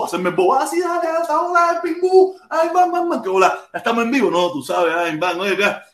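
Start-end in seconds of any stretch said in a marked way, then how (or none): 0.5-4.3 high, sweet fake-friendly voice; high playful play-dumb greeting. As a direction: act the innocent guest arriving on the show. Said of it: "voy a hacerme el bobo".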